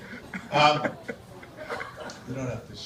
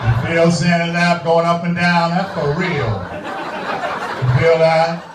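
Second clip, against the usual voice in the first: loud, drawn-out mock Santa swagger; 7 dB louder, slower than usual. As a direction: belt it out as a suggestive Santa.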